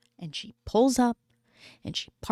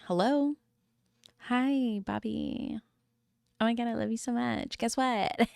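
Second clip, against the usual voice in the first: sugary phone voice; 3 semitones higher, 5 dB quieter than usual. Pretend. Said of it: "I would have been like".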